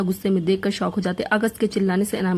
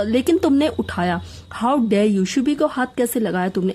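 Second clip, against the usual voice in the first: usual speaking voice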